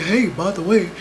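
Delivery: fast, casual mock announcement; mock helpful warning. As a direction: breeze through it like a friendly heads-up. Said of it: "I would be like".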